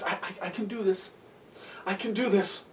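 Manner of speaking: soft, stammered pep talk to himself; steady yourself under your breath; quiet self-reassurance. Acted. Nervous climber steeling himself.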